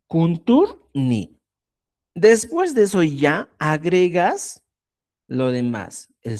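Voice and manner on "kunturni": "kunturni" slowly, with "ni" set apart; syllable by syllable. Shown as a demonstration. Teacher adding ni likewise, syllable by syllable. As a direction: say "kuntur", break, then "ni".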